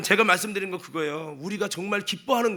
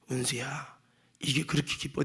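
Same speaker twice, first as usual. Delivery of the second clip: hushed, lower, sorrowful question; sad voice of God; 7 semitones lower, 7 dB quieter than usual. Retold or imitated. God asking the preacher again, in a sad hush. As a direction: ask it softly, disappointed. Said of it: "하나님이 슬픈 목소리로".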